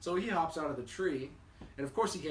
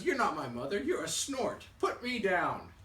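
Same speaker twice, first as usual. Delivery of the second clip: put-on raised, indignant baby bird; storyteller's high, loud little-bird objection; loud protest; 6 semitones higher, 5 dB louder than usual. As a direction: demand to be let go.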